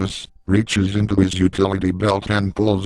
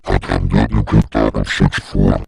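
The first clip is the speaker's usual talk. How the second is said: much lower and louder, a booming monster voice; monstrous dragon lady; 12 semitones lower, 4 dB louder than usual. Acted. The Dragon Lady teacher berates a student in a deep, booming monster voice. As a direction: growl it down low like a beast scolding a pupil. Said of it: "she's all like".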